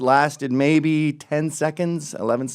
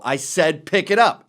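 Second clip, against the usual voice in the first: louder, higher barked repeat; angry order; 3 semitones higher, 5 dB louder than usual. Retold or imitated. Man yelling at dog owner, angry repeat. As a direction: push the last word out hard.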